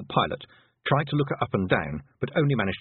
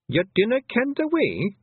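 much higher, slow Scots question; surprised Rob Anybody; 13 semitones higher, 4 dB louder than usual. A narrator's reading. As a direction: ask in disbelief.

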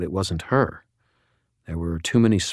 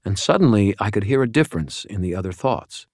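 usual speaking voice

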